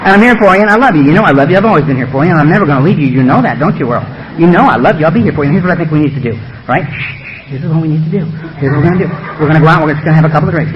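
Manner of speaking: low-pitched, smooth mock devotion; coaxing beast. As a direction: reassure like a seductive false friend. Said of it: "the beast whispers up and say".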